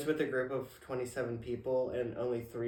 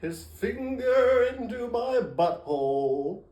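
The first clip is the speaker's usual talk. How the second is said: slow, loud, raised announcer parody; mock voiceover; 6 semitones higher, 6 dB louder, slower than usual. Imagining a voiceover for Grindr hookups, mock announcer voice. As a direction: draw it out like an over-the-top voiceover. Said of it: "Could you imagine my voiceover?".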